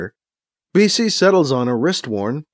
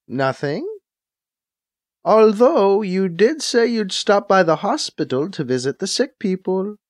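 higher-pitched secretary's voice; secretary on a call; 5 semitones higher than usual. Acted. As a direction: play the secretary answering her boss, pitched up. Secretary character on the link.

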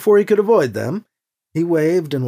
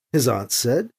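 ordinary delivery

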